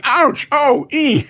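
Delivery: high-pitched, playful yelps; mock cries of pain. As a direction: imitate someone stung, lightly hammed-up. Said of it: "and he's crying".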